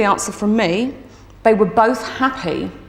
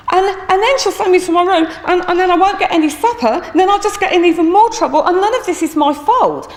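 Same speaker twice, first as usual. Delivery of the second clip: high, hurried mock-tearful wail; imitated crying child; 9 semitones higher, 4 dB louder than usual. Second boy dreads punishment at home, mock crying. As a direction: play a boy piling up his woes, louder. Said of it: "And now Toby starts crying".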